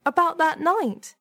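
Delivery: high, nervous woman's voice; worried, high-voiced reply; anxious. A narrator's reading. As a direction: answer timidly, pitched well up. Frightened temp answers him.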